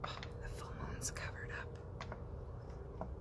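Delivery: a slow, hushed whisper. Real, not acted. Vlogger outside at night, disappointed, slow whisper. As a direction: murmur it to the night sky.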